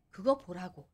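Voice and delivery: ordinary delivery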